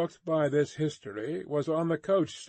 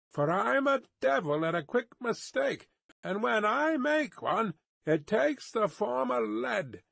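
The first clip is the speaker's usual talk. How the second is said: high-pitched rustic guard giving orders; high, stern character voice; wary guard, threatening; 8 semitones higher than usual. A narrator's reading. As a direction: warn the rider off any tricks. Guard warns approaching rider.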